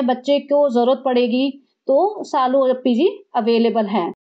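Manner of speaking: normal talking voice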